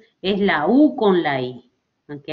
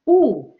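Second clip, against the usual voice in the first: "U" alone, higher and forceful; stressed U; 6 semitones higher, 4 dB louder than usual. Shown as a demonstration. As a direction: punch out the vowel "U" by itself. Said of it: "la mayor fuerza de voz está en U".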